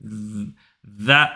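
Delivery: "Z-" held and buzzed low; buzzing z. Shown as a demonstration. Teacher isolating the z sound, buzzing low. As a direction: buzz the z low like a bee. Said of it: "Le bout de la langue".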